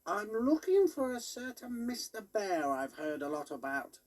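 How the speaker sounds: high squeaky toy pig, put out. A narrator's reading. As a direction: play the knitted pig toy, a bit offended. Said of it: "said Dog Hog".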